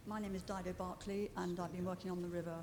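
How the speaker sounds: too far from the microphone, faint; far from the mic. Real, not acted. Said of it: "You're going to need to speak much more closely to the microphone".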